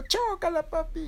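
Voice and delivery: very high squeaky chick; Berilio. Acted. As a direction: chirp it to dad in a tiny voice. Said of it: "Y Berilio dijo".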